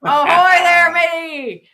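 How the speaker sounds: loud, high hammed-up pirate hail; old sailor. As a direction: play a cheesy salty sea dog. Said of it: "I suck at pirate speak".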